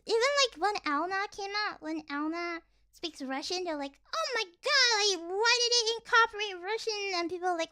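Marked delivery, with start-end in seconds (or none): 4.2-7.2 mock outrage. Imitating critics of a Russian-speaking character, mock outrage. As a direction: imitate a whining, scandalised complainer.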